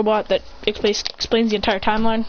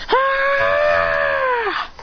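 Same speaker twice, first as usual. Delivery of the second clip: high battle scream. Acted. Attack unleashed.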